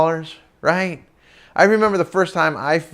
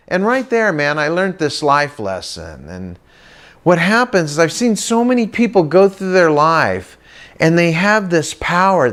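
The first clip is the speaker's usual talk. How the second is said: ordinary delivery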